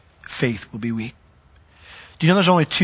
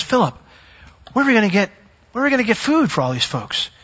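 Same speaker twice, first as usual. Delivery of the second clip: a hint of Jesus posing a test, higher; lightly voiced teacher quizzing his student; Jesus testing Philip, lightly voiced; voicing Jesus; 7 semitones higher than usual.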